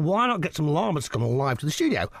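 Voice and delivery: ordinary talk, nothing marked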